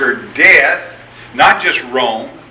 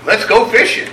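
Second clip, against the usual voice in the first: higher, bright burst of resolve; eager Peter; 9 semitones higher, 5 dB louder than usual. Retold or imitated. Peter announcing to the disciples, high and eager. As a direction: blurt it like a restless fisherman. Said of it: "what are you gonna do".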